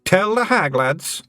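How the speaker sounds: high Scots little man, cheerfully frank; a wee Scottish thief owning up; Feegle voice. A narrator's reading. Feegle admits stealing to Tiffany, high and cheerful. Twiddler prompts clan.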